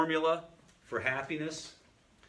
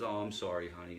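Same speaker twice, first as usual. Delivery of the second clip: soft, lower, sorry murmur; remorseful apology; 6 semitones lower, 4 dB quieter than usual. Retold or imitated. Father to daughter after yelling at her, remorseful. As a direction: act out a guilty, gentle apology. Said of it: "And he feels horrible".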